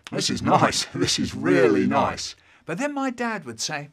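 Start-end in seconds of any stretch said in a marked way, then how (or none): 0.1-2.3 mock relish. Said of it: "we'd sit round saying".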